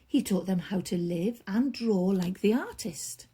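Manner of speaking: plain reading voice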